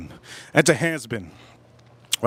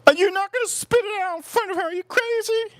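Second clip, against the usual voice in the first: much higher, mock girlfriend voice. Girlfriend scolding him for wanting to spit food, much higher mock voice. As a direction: squeak it out as the shocked girlfriend.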